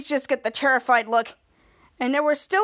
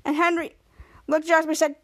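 ordinary delivery, nothing marked

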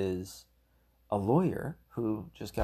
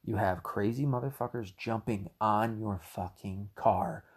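normal talking voice

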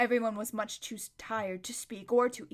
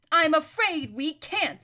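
firm refusal; 6 semitones higher, 8 dB louder, slower than usual. A narrator's reading. Aravis refusing firmly.

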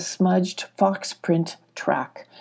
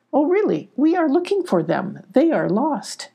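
high, delighted eagerness; huge smile; 7 semitones higher than usual. A narrator's reading. Sylvie hearing news of the strangers, high and delighted. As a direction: say it high through a big smile. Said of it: "said with a huge smile".

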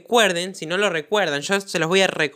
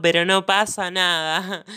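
ordinary talk, nothing marked

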